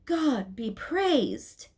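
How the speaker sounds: slow, drawn-out cry of praise; exclaimed. A narrator's reading. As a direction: marvel aloud, stretching each word. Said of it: "one of them exclaimed".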